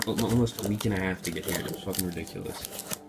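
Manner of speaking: ordinary delivery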